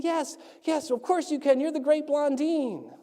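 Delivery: high-pitched cheering, without real volume; mock crowd screaming. Crowd answering the tightrope walker, mock excited screaming. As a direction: play an adoring crowd egging him on. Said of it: "the crowd starts screaming".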